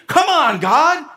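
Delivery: high, pleading protest to God; pleading Habakkuk. Retold or imitated. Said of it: "That's what Habakkuk is feeling".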